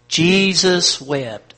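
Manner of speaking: each word drawn out slowly and loudly; slow, loud group recital. Shown as a demonstration. Preacher leading the church in reciting, slow and loud. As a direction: lead the room through it, slow and loud. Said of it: "One more time".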